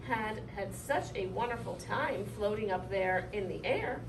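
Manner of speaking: ordinary delivery, nothing marked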